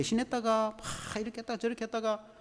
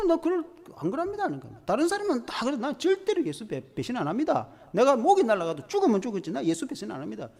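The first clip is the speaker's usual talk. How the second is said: higher, insistent vow of loyalty; Peter protesting; 4 semitones higher than usual. Acted. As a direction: protest hard that you'd never betray him. Peter vows never to betray.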